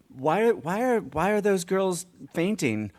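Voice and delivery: put-on high, puzzled child voice; daughter's question. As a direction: lightly voice a curious young girl.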